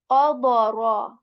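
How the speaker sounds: "oboro" loud, slow, each syllable drawn; slow demo word. Shown as a demonstration. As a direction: drag out "oboro" to model it. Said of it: "another example of this word".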